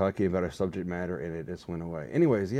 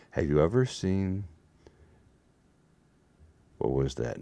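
usual speaking voice

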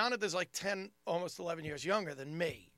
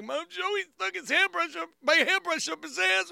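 put-on high, loud outraged child; mock distressed little-brother voice; tattling kid brother; 12 semitones higher, 7 dB louder than usual. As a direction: cry out to Mom in upset complaint. Young brother tattling to mother, acted.

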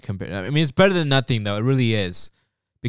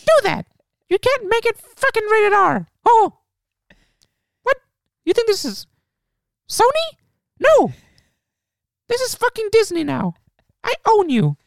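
piercing squeak, very high; bossy squeaky cartoon mouse; Mickey Mouse voice. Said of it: "I'm gonna do the Mickey Mouse voice".

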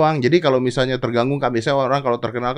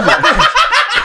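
very high, loud laugh; high peals of laughter; 5 dB louder than usual. Real, not acted. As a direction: burst out laughing when caught out. Caught still making digs.

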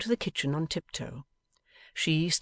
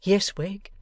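higher reply through a door; 6 semitones higher, 5 dB louder than usual. A narrator's reading. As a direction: answer from behind the door. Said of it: "through the keyhole".

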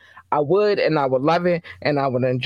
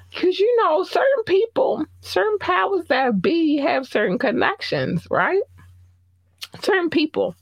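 insinuating; 14 semitones higher than usual. Real, not acted. Insinuating gossip about connections.

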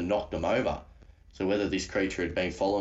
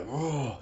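mock roar, higher than usual speech; imitated creature roar; 5 semitones higher than usual. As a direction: imitate a roaring beast at normal volume. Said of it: "the roar it was doing was loud".

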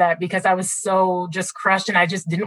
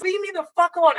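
retold upset outburst; 10 semitones higher, faster than usual. Upset fan to coworkers bringing the news, exasperated. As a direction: re-enact pushing everyone away, voice pitched up.

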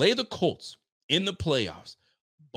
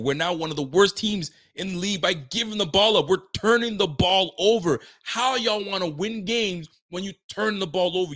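frustrated rant; 4 semitones higher, 3 dB louder than usual. Real, not acted. Rising rant about turnovers.

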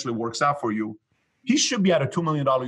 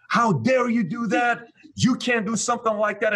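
snapping angrily; 10 semitones higher than usual. Retold or imitated. Employee snapping at his boss over the bonus split, outraged. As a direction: bite back like he's been insulted. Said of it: "He snapped at me".

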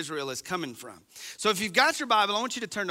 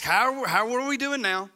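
higher mock-innocent questioning; sarcastic questioning; 4 semitones higher, 3 dB louder than usual. Israelites questioning God, mock-innocent. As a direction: toss the question back sarcastically. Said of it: "They ask".

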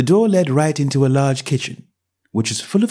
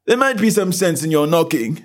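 high, indifferent servant voice; a bored doorman brushing her off; dull footman; 7 semitones higher than usual. A narrator's reading. Footman goes on.

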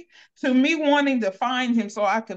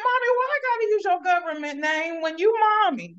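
quick, higher, playful complaint; playful tease; 6 semitones higher, faster than usual. Retold or imitated. Daughter teasing her mother, quick playful whine. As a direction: tease her fast and bright. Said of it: "I'd be like".

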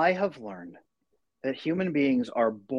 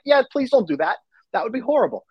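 much higher, louder imitation of a wife; pleading wife; 11 semitones higher, 5 dB louder than usual. Husband quotes his wife's reply, high and pleading. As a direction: plead against it in a lightly mocked-up high voice.

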